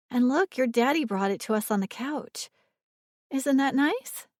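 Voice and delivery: coaxing. A narrator's reading. Coaxing a sleepy child.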